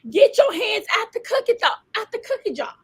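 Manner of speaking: rising preacher cadence, calls and drops; preaching. Acted. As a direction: preach it out to the listeners. Preaching to listeners.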